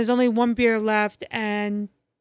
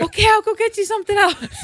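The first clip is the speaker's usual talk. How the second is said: high comic character voice; imitating an over-eager host, laughing; Dale; 10 semitones higher, 4 dB louder, faster than usual. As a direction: offer help in hammed-up high pitch. Acts out Dale offering another breakfast.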